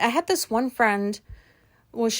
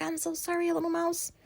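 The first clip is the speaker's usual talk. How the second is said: hushed and higher, barely voiced; softer, higher plea to the little mouse; soft apology; 7 semitones higher, 9 dB quieter than usual. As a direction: coo softly to a small animal you can't see. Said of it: "whispering out there".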